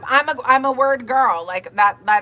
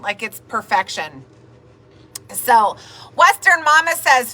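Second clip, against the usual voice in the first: ordinary talk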